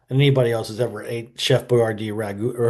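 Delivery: ordinary talk, nothing marked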